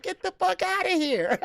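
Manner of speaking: high giggling brush-off; laughing retort again. Retold or imitated. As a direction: squeal it out through laughter. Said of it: "I said".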